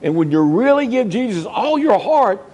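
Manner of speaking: high, rising preacher's appeal; preacher pleading with the congregation to keep their first love, fervent; fervent preaching. Acted. Preacher urges shouting hallelujah.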